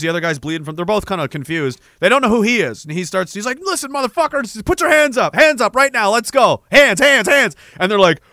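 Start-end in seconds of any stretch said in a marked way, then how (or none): 3.6-7.5 high, loud barked commands; imitated soldier's orders. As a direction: bark urgent orders like a soldier. Acting out soldier ordering a surrender.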